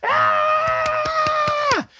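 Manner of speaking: sudden high-pitched cheering shriek; fan cheer. Retold or imitated. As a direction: let out an excited fan's squeal. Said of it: "And we all go".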